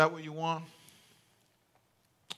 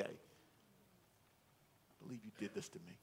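low, near-whispered resentment; bitter muttered grievance; 4 semitones lower, 26 dB quieter, much slower than usual. Acted. A congregant brooding over a hurt, in a low murmur. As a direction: voice the hurt one quietly brooding.

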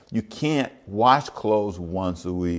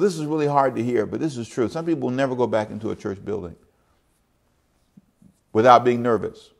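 ordinary talk

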